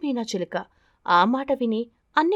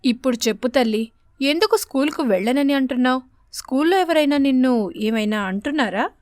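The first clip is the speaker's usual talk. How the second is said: put-on louder, tender mother coaxing her child; 2 semitones higher, 4 dB louder than usual. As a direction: gently urge the frightened girl to speak. Mother coaxing scared daughter. (కూతుర్ని బుజ్జగిస్తూ అడిగే అమ్మ గొంతు)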